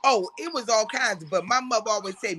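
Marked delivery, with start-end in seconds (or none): none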